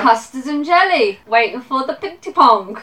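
put-on playful London Cockney accent; mock Cockney, high and loud. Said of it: "my mum's London Cockney".

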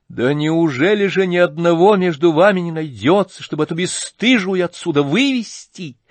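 high, loud, furious outcry; trembling with anger. Acted. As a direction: cry out shaking with rage. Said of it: "вся трепеща от гнева Варя".

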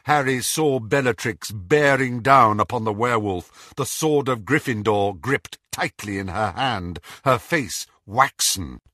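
usual speaking voice

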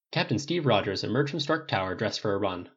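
plain reading voice

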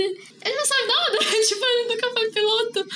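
laughing. Real, not acted. Laughing at the colonel's twist.

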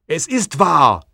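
furious accusing shout, high and loud; outraged yell at the creature. Acted. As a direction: hurl the accusation at him. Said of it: "schreie ich".

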